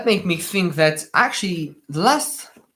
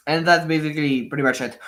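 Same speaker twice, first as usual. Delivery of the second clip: normal talking voice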